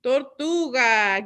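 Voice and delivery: "Tortuga" said slowly with Spanish sounds; slow Spanish model. Shown as a demonstration. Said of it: "Can you say tortuga?".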